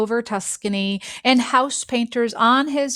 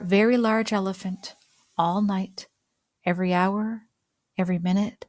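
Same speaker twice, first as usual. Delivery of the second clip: ordinary delivery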